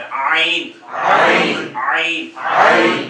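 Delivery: आइन squeezed from the mid throat, slow and pressed; throaty ain. Shown as a demonstration. Teacher shows the class आइन, slow and strained. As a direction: tighten the throat and stretch आइन. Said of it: "गला खींच रहा है".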